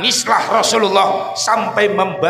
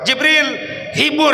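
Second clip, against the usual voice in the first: commanding call; 9 semitones higher than usual. Acted. God commanding Jibril.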